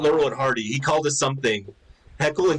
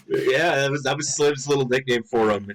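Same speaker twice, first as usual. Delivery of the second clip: ordinary delivery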